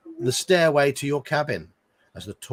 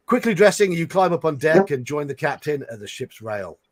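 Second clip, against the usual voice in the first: ordinary delivery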